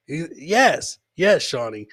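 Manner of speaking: ordinary delivery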